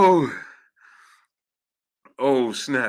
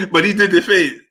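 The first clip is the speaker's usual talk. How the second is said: high voice through laughter; cracking up; 6 semitones higher than usual. Real, not acted. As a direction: get it out while laughing hard. Cracking up over the retold moment.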